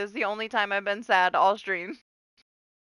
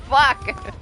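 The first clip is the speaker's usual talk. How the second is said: ordinary talk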